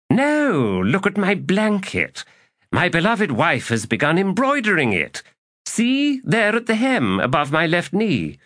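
high, delighted king showing off; beaming, proud Tehol. A narrator's reading. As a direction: pitch it high with boastful delight. Tehol shows off his blanket.

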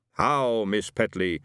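lightly put-on spirit guide greeting; a hint of a hammy chief's greeting; Geronimo voice. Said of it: "she said, as Geronimo".

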